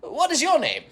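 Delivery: high, formal wizard voice; loud theatrical fantasy wizard; dragonborn wizard. Acted. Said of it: "I am a dragonborn wizard".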